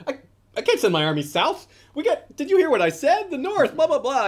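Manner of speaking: high-pitched, loud incredulous objection; exasperated mock protest. Acting Jon's exasperated refusal.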